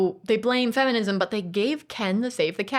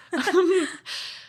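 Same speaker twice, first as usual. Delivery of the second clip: a bright burst of laughter, pitched up; high laugh; 10 semitones higher than usual. Real, not acted. A laugh breaking in while mocking the critics' outrage. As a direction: laugh at the friend's annoyed face. Laugh after annoyed look.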